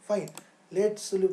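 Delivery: ordinary talk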